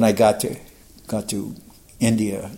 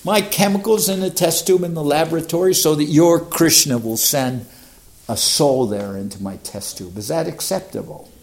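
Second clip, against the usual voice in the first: higher and louder, a confident provocation; cheeky young challenge; 6 semitones higher, 5 dB louder than usual. Retold or imitated. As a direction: lay out the test-tube dare proudly. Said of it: "I'm young".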